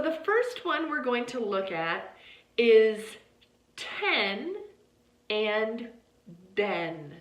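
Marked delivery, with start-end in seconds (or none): 3.8-4.6 ten with a puff of air on the t; breathy t at the start of ten; aspirated t. Shown as a demonstration. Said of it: "Do you hear how with t, there's more breath with it". 6.6-7.1 den with a voiced d, no puff of air; den, d voiced with no breath. Shown as a demonstration. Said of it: "It just sounds like the voice, d".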